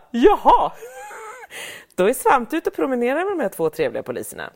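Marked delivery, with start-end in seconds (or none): 0.1-0.7 high, loud startled jaha; a startled jaha with a laugh; surprised jaha. Retold or imitated. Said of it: "vi bara". 0.8-1.9 high, squealing burst of laughter. Real, not acted. Laughing at the surprise photo.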